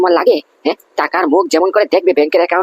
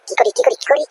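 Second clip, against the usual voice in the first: frantic high fretting; 10 semitones higher than usual. Acted. Aunt caught, frantic.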